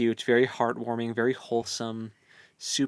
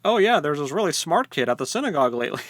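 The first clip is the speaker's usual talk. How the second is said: higher, louder mock-cheesy quote; tongue-in-cheek mock; 4 semitones higher, 6 dB louder, faster than usual. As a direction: lay the cheese on thick, a bit faster. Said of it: "so tongue-in-cheek".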